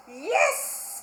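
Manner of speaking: high, happy yes; joyful cry. Acted. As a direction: cheer it out. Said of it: "cried the witch".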